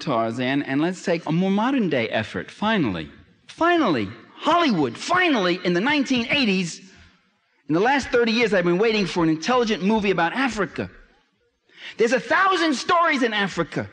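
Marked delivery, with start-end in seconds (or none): 3.5-13.9 higher and rising, fervent lecture climax; impassioned build. Real, not acted. Lecturer to audience about African stories, impassioned and high. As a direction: pour it out, each list item climbing.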